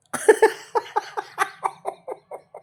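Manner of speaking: airy, unvoiced giggle; breathy laugh. Real, not acted. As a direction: let a breathy laugh escape. Parent laughing at baby. (श्वासातलं हसू)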